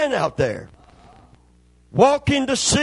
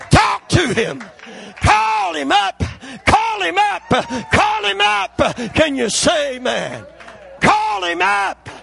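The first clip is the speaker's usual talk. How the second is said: loud, higher shouted preaching climax; preaching at full force; 5 semitones higher, 3 dB louder than usual. Acted. Preacher urging the congregation to call on God, shouting at full force. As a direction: build to a shouting revival peak.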